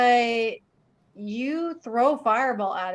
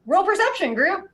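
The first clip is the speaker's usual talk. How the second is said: high bright call; excited; 10 semitones higher, 4 dB louder than usual. Real, not acted. GM calls for roll.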